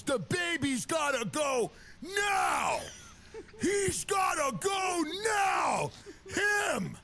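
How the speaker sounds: high, slow hammed-up yell ordering the baby out; mock bouncer shouting. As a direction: bellow it slowly like a pompous bouncer pointing at a toddler. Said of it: "and goes".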